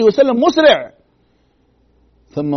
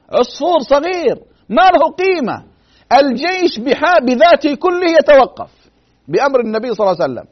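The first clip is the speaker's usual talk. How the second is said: louder, higher preaching in wonder; impassioned preaching; 6 semitones higher, 4 dB louder than usual. Acted. Preacher to the congregation, higher and louder in awe. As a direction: marvel aloud at the whole army stopping.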